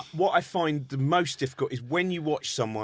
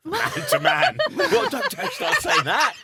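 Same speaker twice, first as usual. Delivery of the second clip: high laughing objection; laughing protest; 13 semitones higher, 4 dB louder, slower than usual. Real, not acted.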